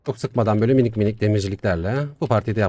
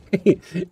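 quick bright burst of laughter; a sudden high laugh; short laugh; 9 semitones higher than usual. Real, not acted. Gamer laughing at his game, quick high burst. Amused during gameplay.

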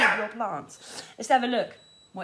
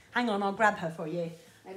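ordinary talk

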